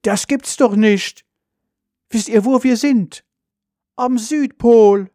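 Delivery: loud, high, drawn-out exclamation; calling out. A narrator's reading. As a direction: cry out the astonishing discovery. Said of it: "und ruft". (laut und hoch gerufen, gedehnt)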